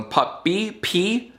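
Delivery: "puppy" pitched high, then "P" alone; isolated final syllable. Shown as a demonstration. Showing viewers the syllable that rhymes with E, isolated final syllable. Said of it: "the final syllable of this one".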